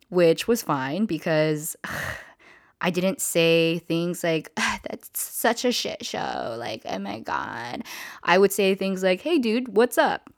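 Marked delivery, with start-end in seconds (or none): 4.6-7.8 mock a rich coworker's lazy complaint; bougie coworker. Imitating rich steakhouse coworkers she didn't fit with, slow bougie complaint. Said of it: "was super bougie". 9.2-10.3 high, easygoing greeting; casual dude talk. Retold or imitated. Hostess imitating her own casual talk at work, chummy. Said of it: "for talking the way that I did".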